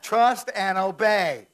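loud, slow, each word hammered; emphatic proclamation. Acted. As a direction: proclaim it with full force. Preacher drives home the key phrase.

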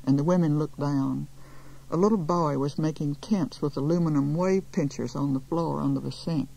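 plain reading voice

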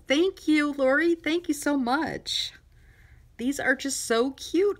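high, loud, gushing thanks; excited. Real, not acted. Thanking the gift sender for embroidered pieces, excited. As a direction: gush with real delight, stretching the last words. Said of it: "I got a little excited there".

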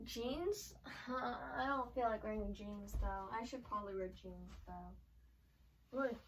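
quiet, away from camera. Real, not acted. Talks low, away from mic.

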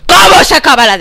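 loud, high frustrated yell; exasperated shout. Real, not acted. Gamer stuck in the demo, loud exasperated yell. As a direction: shout the question at the screen.

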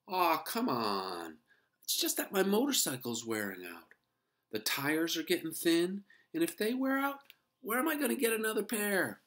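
rising, pleading squeak; pleading mouse voice. A narrator's reading. Ralph pleading excuses.

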